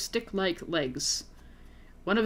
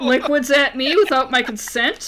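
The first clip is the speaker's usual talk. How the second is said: loud, higher furious retort; angry outburst; 4 semitones higher, 7 dB louder than usual. Acted. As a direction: snap back in indignant rage. Angry reply to alien.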